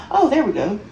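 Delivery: ordinary delivery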